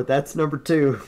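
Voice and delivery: ordinary talk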